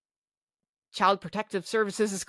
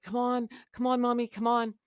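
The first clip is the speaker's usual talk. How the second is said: higher, soft urging voice; pleading young son; 5 semitones higher, 3 dB quieter than usual. Retold or imitated. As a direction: gently imitate a child coaxing a parent. Retold son urging mother up.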